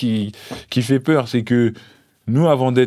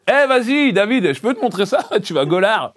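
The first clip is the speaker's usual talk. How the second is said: high, giggly open-space joker; hammed-up office banter with laughs; mocked comfortable office guy; 10 semitones higher than usual. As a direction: mimic a carefree coworker, pitched high. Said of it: "humour de gars trop à l'aise".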